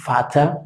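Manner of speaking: Vater: v suena f, fata; v alemana como f. Shown as a demonstration. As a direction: di Vater con f inicial. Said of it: "como si fuera una F". (Vater como fata)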